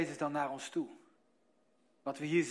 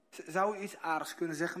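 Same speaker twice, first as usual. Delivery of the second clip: ordinary talk, nothing marked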